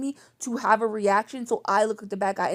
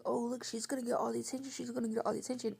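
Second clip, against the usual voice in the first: quick, soft mocking singsong of the taunt; mocking sneer; 2 semitones higher, 9 dB quieter, faster than usual. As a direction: sneer it fast like a spiteful sister.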